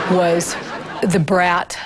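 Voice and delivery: ordinary delivery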